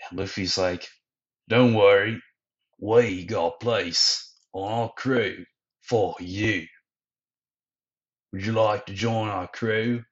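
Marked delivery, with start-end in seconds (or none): 1.5-10.0 slow, lightly put-on hero voice; reassuring hero. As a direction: invite him aboard slowly and deliberately. Luffy's invitation in put-on voice.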